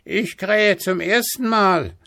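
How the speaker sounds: high-pitched rooster calling down; rufender Hahn. A narrator's reading. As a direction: crow the words from the church tower. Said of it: "rief der goldene Hahn herunter". (hoch herunterrufender Hahn)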